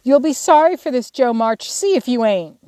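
high, loud vengeful call; angry little-sister warning; threatening. A narrator's reading. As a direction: shout a threat after a departing sister. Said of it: "called over the banisters in a threatening tone".

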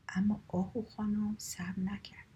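usual speaking voice

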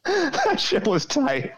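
high silly voice. Pretend. High slow put-on voice.

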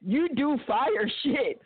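slow, high imitation of the ex; drawn out as if quoting the ex; slow mock quote. Imitating an ex's puzzling compliment, slow and high. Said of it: "Like, what the fuck does that mean".

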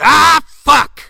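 high loud curse; frustrated shout. Acted. Tex hearing there's only Foster's, shouting. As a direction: yell it in disgust.

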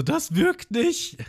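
very high, slow shocked wail; shocked cry. Retold or imitated. Johnny and Yosaku seeing Arlong stand up, very high slow wail. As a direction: wail in disbelief.

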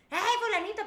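high, loud "hey" to get someone's attention; calling out. Shown as a demonstration. Showing how to call someone over, high loud call-out. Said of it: "si quiere llamar a alguien".

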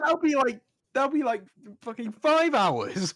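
high, disbelieving protest; exasperated. Real, not acted. Exasperated at long episode idea.

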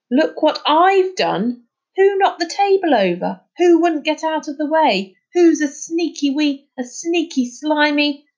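angry retort. A narrator's reading. Charlie turning on Finn, angry and accusing. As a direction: fling each question back at him. Angry boy turns the blame.

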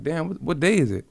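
suddenly realizing days have vanished; a replayed jolt of confusion; retold shock. Retold shock at lost time.

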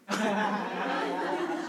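quiet, light laugh at her own punchline; soft laugh. Real, not acted. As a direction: chuckle softly at the joke. Speaker laughs at own joke.

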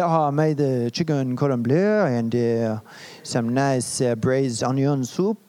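put-on low, slow French chef's-child accent. Child telling a schoolmate about the French chef family's dinner, French accent. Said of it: "that's my best French accent of the day".

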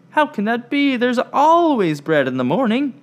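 high, loud call of "But Ella"; shouting after her. Acted. Fiona calling after Ella as she leaves, loud and high. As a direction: call her back urgently. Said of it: "Fiona shouted after her".

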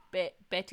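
Betty sounded out as "beh-tee"; Betty in the Spanish way; spelled like Beti. Shown as a demonstration. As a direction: break Betty after "Be", with a crisp t. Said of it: "B E T I".

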